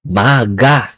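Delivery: maga, voiced G; correct maga. Shown as a demonstration. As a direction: say maga, the G voiced between vowels. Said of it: "Not mak-a, maga".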